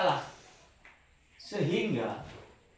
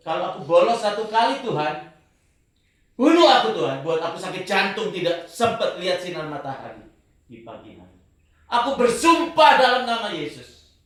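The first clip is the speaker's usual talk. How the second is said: fervent oath; 8 dB louder than usual. Retold or imitated. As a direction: cry the oath out with all your heart. Swearing oath before God. (sumpah lantang penuh semangat kepada Tuhan)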